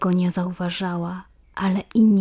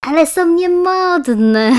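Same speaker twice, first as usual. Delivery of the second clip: much higher, louder, slow jeering then a giggle; mocking schoolgirl; 10 semitones higher, 8 dB louder, much slower than usual. A narrator's reading. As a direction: sneer at the dress and laugh. Iska mocks Asia's dress.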